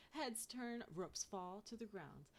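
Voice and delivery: usual speaking voice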